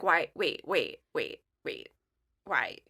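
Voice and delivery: imitating stunned stammer, low and dropping away; brain stopped. Imitating an old coworker recognising her, dumbfounded. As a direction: repeat it haltingly, stuck in a mental loop. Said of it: "Like, his brain stopped".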